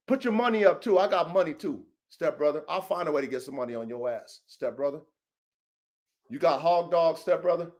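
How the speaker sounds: low, muttered imitation of a rival; mock rival voice. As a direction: put on a meek rival voice to mock him. Mocking a rival's pleas.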